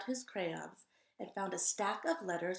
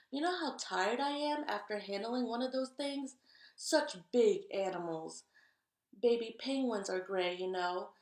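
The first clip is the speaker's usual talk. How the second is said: exhausted gray crayon; 2 semitones higher, 6 dB louder than usual. Acted. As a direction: moan about the elephants. Gray Crayon's letter voiced.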